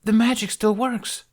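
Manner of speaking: high, thrilled exclamation; a boy thrilled the magic lives; excited. Acted. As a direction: burst out with delight. Jamie excited by glowing runes.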